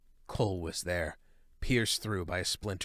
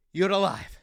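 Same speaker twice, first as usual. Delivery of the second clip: excited relief; 9 semitones higher, 8 dB louder than usual. A narrator's reading. Joyous exclamation.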